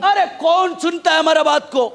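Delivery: loud, high acted challenge; mock scoffing shout. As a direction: call it out like a defiant scoffer. Acts out the scoffers' boastful question. (ऊँची आवाज़ में ललकार की नकल)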